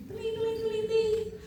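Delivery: high, soft bell imitation; school bell ding. To the class, mimicking the recess bell softly.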